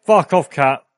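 sudden startled curse at cat; startled yell. Real, not acted. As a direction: snap it out in alarm.

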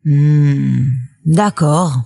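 grognement grave puis accord résigné; grognon. A narrator's reading. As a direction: grogne ton accord, plus bas. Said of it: "grogna le méchant". (loup qui grogne à contrecœur)

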